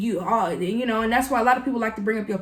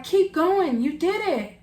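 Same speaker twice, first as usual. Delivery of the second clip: cheering a friend on; 9 semitones higher, 4 dB louder, slower than usual. Retold or imitated. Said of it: "And it was like".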